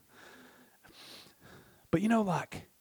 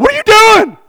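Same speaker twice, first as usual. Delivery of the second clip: high, loud mock shriek; alarmed wife; 15 dB louder than usual. Wife to husband cashing out savings, alarmed shriek. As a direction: shout it in outraged disbelief.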